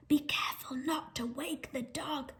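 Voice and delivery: whispered warning. A narrator's reading. Thief whispering the plan in the dark, a whisper. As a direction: whisper so the dog stays asleep. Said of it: "she heard them whisper".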